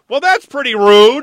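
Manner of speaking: high, loud indignant pop; mock offended. Real, not acted. Host mock-offended at the Weather Channel's verdict. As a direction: act wounded by the insult.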